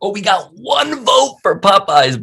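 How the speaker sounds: high, drawn-out put-on announcement; mock astonishment. Host revealing a lone Popeyes vote, mock astonished. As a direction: stretch it high as if scandalized. Mock reaction to poll result.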